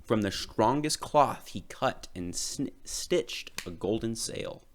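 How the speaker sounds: plain reading voice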